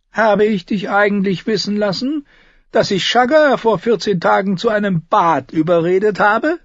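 high, loud character voice; Tyrion, wry. Acted.

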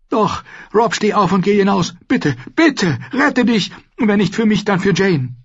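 high, anxious urging; urgent pleading. A narrator's reading. Mother begs wounded son to save himself, pleading. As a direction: coax him up and out.

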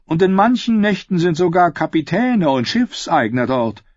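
high, animated bragging; boasting. A narrator's reading. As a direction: pile on the brag, pitched well up. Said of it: "prahlte er". (prahlerisch und mit hoher Stimme weitererzählt)

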